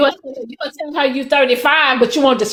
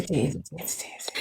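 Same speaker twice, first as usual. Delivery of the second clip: hushed, breathy whisper of a name; 9 semitones lower, 15 dB quieter than usual. Acted.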